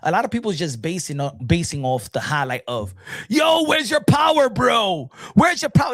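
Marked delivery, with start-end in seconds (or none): 3.3-5.9 loud, high heckling shout; mimicked critics. Critics heckling off the highlights, mimicked high shout. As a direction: yell it like an outraged fan.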